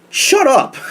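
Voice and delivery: high-pitched mock snap; shrill mock snap. Mock Charlotte snapping.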